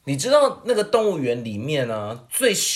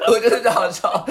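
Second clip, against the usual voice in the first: 我觉得比较好笑 said higher and giggling; laughing harder; 6 semitones higher, 4 dB louder, faster than usual. Real, not acted. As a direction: repeat it higher through real laughter. Laughing while judging jokes.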